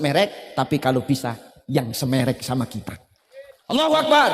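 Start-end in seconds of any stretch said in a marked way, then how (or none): 3.7-4.3 takbir call. Real, not acted. Preacher calls takbir to crowd.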